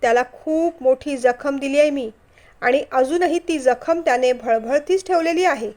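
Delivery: usual speaking voice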